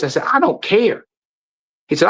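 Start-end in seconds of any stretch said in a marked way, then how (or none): 0.2-1.0 retold defiance. Retold quarrel with doctor.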